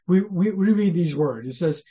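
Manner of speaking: ordinary delivery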